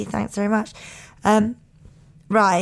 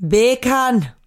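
"Beer can" with more boomf, a Jamaican sound; Jamaican accent hack. Shown as a demonstration. Host retrying the Jamaican accent hack with more boomf. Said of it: "You gotta say it with a bit of boomf".

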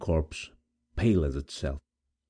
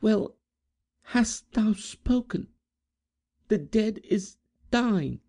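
high, slow, fragile voice; gentle maiden; slower than usual. Acted. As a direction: Edith giving up the dead. Gentle girl answers her rival.

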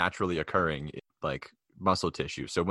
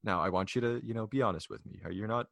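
soft, hushed imitation of a concerned mother; confiding mom; 2 semitones higher, 7 dB quieter than usual. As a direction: take someone aside and ask gently. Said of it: "She's like".

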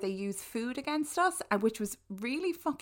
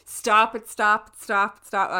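loud pleading; 10 dB louder than usual. Real, not acted. Begging friend to stop teasing.

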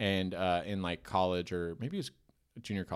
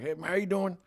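lightly voiced scruffy country uncle, pitched up; a higher, casual put-on uncle greeting; 7 semitones higher than usual. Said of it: "methy looking kinda uncle".

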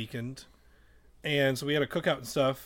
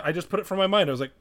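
ordinary talk, nothing marked

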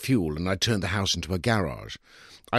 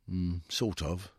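soft, slow mumble, barely committing; a reluctant, slow half-answer, quieter; mumbled; 7 dB quieter, slower than usual. A narrator's reading. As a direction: mutter it down into the plate. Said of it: "he mumbled".

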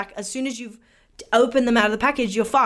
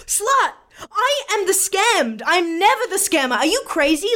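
higher-pitched, exaggerated indignation; mock indignant retort; 8 semitones higher than usual. Seller retorts to suspicious buyer, mock indignant. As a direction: protest hotly, as if wrongly accused.

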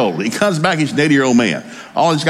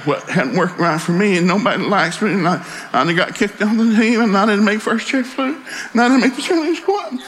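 high-pitched whiny complaint, climbing higher; mock whining; 8 semitones higher than usual. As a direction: put on a self-pitying whine that keeps rising. Mocking kids' self-pitying complaints.

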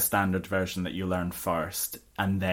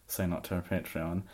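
usual speaking voice

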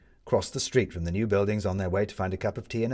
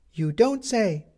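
put-on high, bored young boy; boy losing interest, voiced high; 12 semitones higher, 4 dB louder, slower than usual. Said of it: "said the boy, losing interest".

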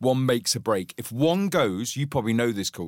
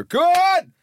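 high loud shout; shooing shout; 6 dB louder than usual. Retold or imitated. Man shooing sheep through the gate with a high yell. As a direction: yell to drive them off.

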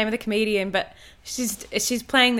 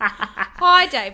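politely impatient; 6 dB louder than usual. Acted. Mock corporate email voice.

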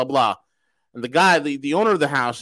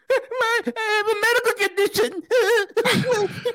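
mocking through laughter, higher; laughing mockery. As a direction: laugh while quoting him. Host mocking agent while laughing.

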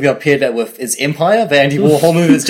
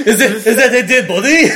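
higher French police officer voice; French accent; 6 semitones higher than usual. Acted. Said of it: "we also have to do French accents".

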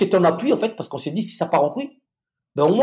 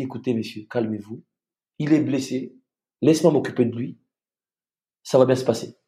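calm reassurance; 5 semitones lower, 3 dB quieter than usual. Retold or imitated. Colonel doctor calming armed guard.

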